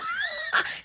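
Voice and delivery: very high burst of laughter; delighted laugh. Real, not acted. Laughs at her own luck.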